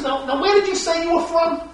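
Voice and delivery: high, pressing question; anxious Pilate. Retold or imitated. Pilate anxiously pressing Jesus about where he is from. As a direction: Pilate leaning in, uneasy and insistent. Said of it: "asked Jesus".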